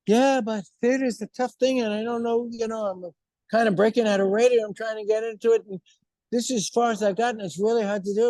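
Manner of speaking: high, hurried mock protest; imitated woman's excuses. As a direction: mimic a friend explaining her struggle. Acts Leslie's excuses in reply.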